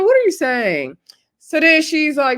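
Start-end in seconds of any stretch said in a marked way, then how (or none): 0.0-1.0 high, louder incredulous question. Retold or imitated. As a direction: react in disbelief, pitched up. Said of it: "And I was like".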